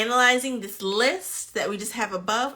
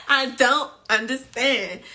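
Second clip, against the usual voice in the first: louder, emphatic first statement; 3 semitones higher, 5 dB louder than usual. Pretend. Host baffled by the players, emphatic and louder. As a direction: say it out firmly at full voice. Repeated mantra that fades away.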